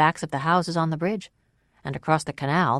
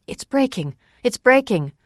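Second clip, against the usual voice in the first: high, urgent outcry; alarmed cry; 9 semitones higher, 6 dB louder than usual. A narrator's reading. Narrator blurts aloud, not knowing what she said, alarmed. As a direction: blurt it twice, louder the second time. Cried out involuntarily amid commotion.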